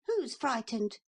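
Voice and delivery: high, quick little boy pretending not to care; boy's defiant voice. A narrator's reading. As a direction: act brave as a small boy. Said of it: "so he just said".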